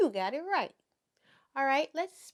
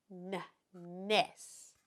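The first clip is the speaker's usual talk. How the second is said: normal talking voice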